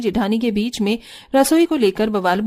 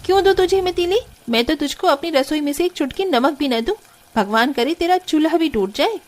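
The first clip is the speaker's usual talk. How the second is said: spiteful refusal; 6 semitones higher than usual. Acted. Jethani refuses matchsticks spitefully.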